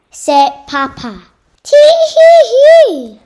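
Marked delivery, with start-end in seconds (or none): 1.6-3.2 high, loud, slow witchy tee hee hee; witch cackle. Acted. Hidden witch giggles.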